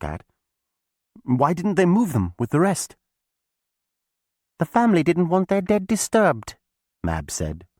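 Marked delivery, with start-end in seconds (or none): 1.2-3.0 put-on high, curious boy's question; Tom asking, higher. A narrator's reading. Tom asks Mab about the bones, curious. 4.6-6.6 high, louder witch voice; Mab the witch. A narrator's reading. Mab explains to Tom, high and knowing.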